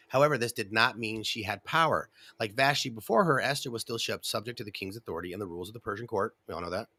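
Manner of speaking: ordinary talk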